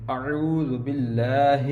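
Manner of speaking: اعوذ باللہ said slowly with the long a in باللہ drawn out; lengthened lam. Shown as a demonstration. Teacher shows students the long vowel in اعوذ باللہ, slowly. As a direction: stretch the لا in باللہ. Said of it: "اس کو لمبا کریں گے".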